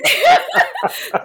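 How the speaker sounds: high, loud burst of laughter. Real, not acted. Laughing before naming her song.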